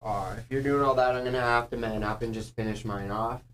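talking through burning mouth. Real, not acted. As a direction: speak with a scorched tongue. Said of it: "My mouth is just on fire".

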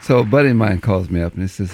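ordinary talk, nothing marked

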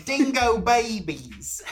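slow, high, loud hammed-up Australian accent; mock Australian accent. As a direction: drag it out like a cartoon Aussie. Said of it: "That's Australian".

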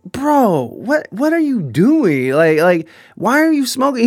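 incredulous. Retold or imitated. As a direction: demand answers in disbelief. Confronting Brandon smoking.

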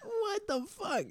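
high, slow, laughing disbelief. Real, not acted. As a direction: ask it while laughing hard. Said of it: "What was that?".

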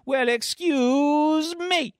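high, long-drawn huffy comeback; mock indignation. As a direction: stretch it out, hammed-up and offended. Acted comeback to being criticized.

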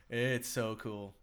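drawn out slowly, relishing it; slow, dreamy delivery; slow savoring. Shown as a demonstration. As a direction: stretch each word out in awe. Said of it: "repeats phrase in varied ways".

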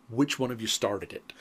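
low, soft, knowing question; quiet question. Retold or imitated. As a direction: ask it quietly, like a friend who wants in on the joke. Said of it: "she looks right at my father and goes".

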